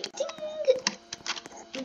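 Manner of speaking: high playful "ding" chime; imitated ding. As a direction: chirp it like a computer bell. Imitating a computer sound.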